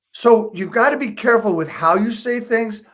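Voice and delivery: ordinary delivery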